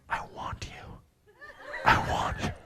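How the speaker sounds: breathy seductive whisper. Retold or imitated. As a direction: whisper it sultry and close. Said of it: "she whispered".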